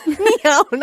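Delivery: high-pitched yelp of the name; loud squeaky Neon. Pretend. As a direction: shout it high and loud, teasing. Friends mocking the name Neon.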